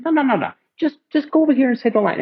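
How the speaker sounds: high, fast mock insistence; imitating Marty directing. Director correcting the actor, high fast impression.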